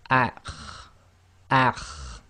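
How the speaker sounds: "akh" scraped hard at the top of the throat; Arabic kha. Shown as a demonstration. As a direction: rasp the kh in "akh" from the upper throat. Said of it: "overemphasize this".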